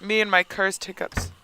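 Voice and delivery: usual speaking voice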